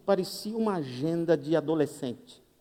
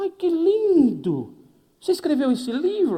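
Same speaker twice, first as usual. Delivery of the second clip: put-on high, loud, gushing old woman; hammed-up gushing old lady; delighted old lady; 9 semitones higher, 6 dB louder, slower than usual. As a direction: gush like a thrilled grandma.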